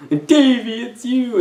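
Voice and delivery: high, breathy mock-tearful reunion line; bad acting. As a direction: ham up a stilted movie reunion. Mocking the film's bad line delivery.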